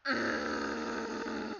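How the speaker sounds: ordinary talk, nothing marked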